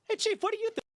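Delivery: very high, chirpy put-on voice; mock eager self. Junior officer hailing his chief, high chirpy mock voice.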